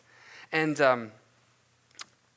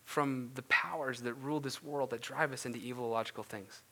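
ordinary delivery

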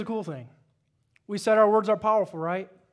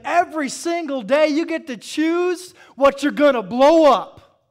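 louder, higher preacher's build; preaching at full force; 7 semitones higher, 10 dB louder than usual. Acted. Preacher firing up the congregation, loud and high. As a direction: drive the point home to the church.

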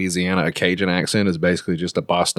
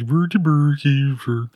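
slow, high mock Swedish Chef gibberish; Swedish Chef imitation; 7 semitones higher, slower than usual. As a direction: ham up a singsong Muppet chef, drawn out. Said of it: "He sounds like the Swedish chef".

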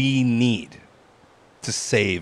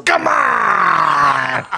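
Futurama boss impression; 6 semitones higher than usual. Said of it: "Fry's Pizza Place boss from Futurama impression".

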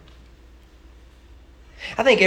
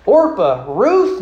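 put-on calling the girls' names, louder and higher; Naomi crying out; 5 semitones higher, 8 dB louder, slower than usual. Naomi calling to her daughters-in-law, a mock cry. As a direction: call out to them like a worried mother-in-law. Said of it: "Naomi cried".

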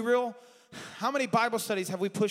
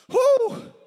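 high shouted whoo; ecstatic whoop; 8 dB louder than usual. Real, not acted. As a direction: let out a high preacher's whoop. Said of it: "preacher's ecstatic whoop".

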